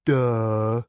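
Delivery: mock a snotty teenage girl. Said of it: "in my best teenage girl voice".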